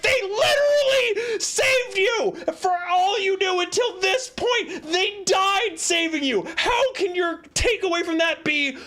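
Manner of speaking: very high, exasperated yell; outraged shouting. Acted. Streamer argues with Ciri's takeaway, outraged high shout. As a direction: shout in disbelief, hammering and stretching key words. Yelling back at the character.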